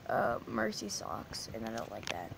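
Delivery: sulking over the socks in a low voice; sulky low voice. Real, not acted. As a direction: drop into a pouty low mumble. Sulking about losing socks.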